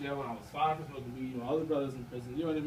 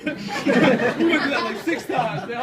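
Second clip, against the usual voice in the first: loud real laughter, slow through the words; laughing; 6 semitones higher, 11 dB louder, much slower than usual. Real, not acted. Telling the group about the nap fight, laughing loudly. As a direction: laugh hard as you say it.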